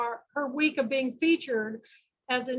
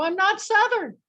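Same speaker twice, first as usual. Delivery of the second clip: higher, louder mock-indignant denial; protesting, pitched up and punched; retold protest; 6 semitones higher, 5 dB louder than usual. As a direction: push back in playful indignation. Retelling her protest at advice.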